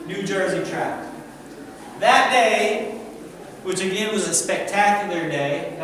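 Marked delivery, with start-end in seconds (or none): none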